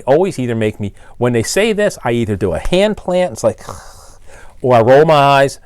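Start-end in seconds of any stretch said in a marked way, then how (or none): 4.1-5.6 exasperated. Real, not acted. Exasperated sigh at callers.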